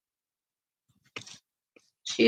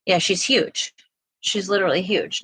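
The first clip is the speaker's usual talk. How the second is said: ordinary talk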